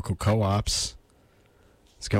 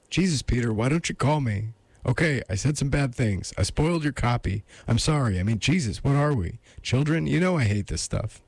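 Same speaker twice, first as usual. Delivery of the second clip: higher-pitched, agitated lady on the phone; agitated woman; 5 semitones higher than usual. Acted. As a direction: plead with him in a higher, agitated voice. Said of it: "resumed in greater agitation".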